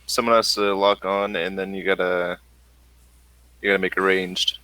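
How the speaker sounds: ordinary delivery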